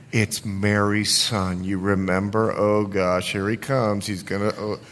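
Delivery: low, hushed grumble from the pews; mock congregation murmuring doubts, soft and low; unimpressed muttering. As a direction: gossip under your breath, unimpressed. Acts out sceptical congregation muttering.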